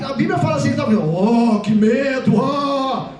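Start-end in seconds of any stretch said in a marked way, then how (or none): 1.6-3.1 tame, half-hearted gasp of fear; understated pretend scare; mock mild fright. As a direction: play the fear far too politely. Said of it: "Você acha que o homem".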